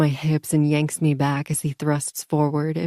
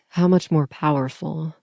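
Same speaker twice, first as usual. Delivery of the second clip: ordinary delivery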